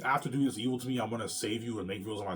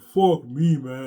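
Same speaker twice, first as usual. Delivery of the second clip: crying out; 5 semitones higher, 13 dB louder, much slower than usual. Retold or imitated. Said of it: "I'm like, crying".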